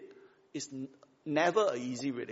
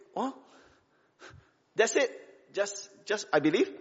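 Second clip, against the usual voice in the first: disbelieving questions, higher and halting; incredulous; 6 semitones higher than usual. Acted. Doubter answering the offer of peace with God, incredulous. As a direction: act out baffled disbelief. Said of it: "just too incredulous lah".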